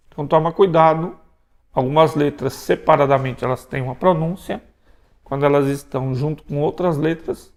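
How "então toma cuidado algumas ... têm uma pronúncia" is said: normal talking voice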